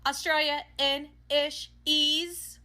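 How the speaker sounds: high, loud, drawn-out syllables; mock dim answer. Clerk naming the language of Australia, slow high mock answer. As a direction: sound out a made-up language slowly and loudly.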